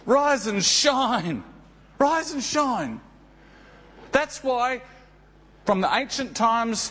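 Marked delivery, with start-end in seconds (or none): 0.0-1.4 slow rise and shine. Shown as a demonstration. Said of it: "Jesus says to you and me". 2.0-3.0 higher rise and shine. Shown as a demonstration. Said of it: "Jesus says to you and me".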